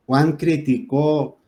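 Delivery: criticó said slowly as cri-ti-CÓ; stress on last syllable. Shown as a demonstration. Teacher giving the past-tense example, criticó with a long stressed CÓ. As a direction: drag out the final CÓ of criticó. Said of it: "es aguda".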